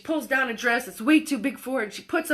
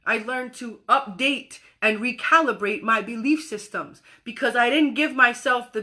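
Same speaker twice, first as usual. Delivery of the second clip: normal talking voice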